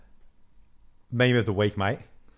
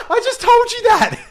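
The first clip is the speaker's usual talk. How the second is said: high laughing squeal of disbelief; laughing, shrieking exasperation; exasperated outburst; 7 dB louder than usual. Retold or imitated. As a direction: cry out in mock outrage. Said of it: "I'm like".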